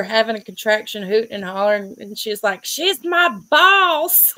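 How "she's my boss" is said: high, slow hammed-up shout; mock yell. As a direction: yell it out mid-contraction, half joking. Patient shouting during contraction.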